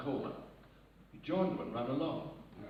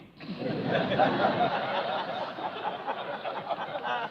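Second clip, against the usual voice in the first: breathless laugh; 11 semitones higher, 6 dB louder than usual. Real, not acted. Laughing at own punchline.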